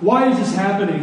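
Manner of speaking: normal talking voice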